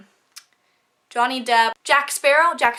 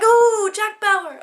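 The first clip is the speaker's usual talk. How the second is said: excited; 11 semitones higher, 7 dB louder, much slower than usual. Retold or imitated. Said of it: "And I'm like".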